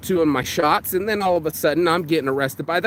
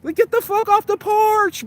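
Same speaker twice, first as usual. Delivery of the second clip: much higher yell at the dog; mock yell at dog. Vlogger yelling at the whining dog, high mock yell. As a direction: shoo the whining dog off the porch.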